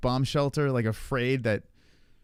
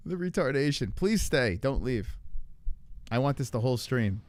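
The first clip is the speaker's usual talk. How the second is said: normal talking voice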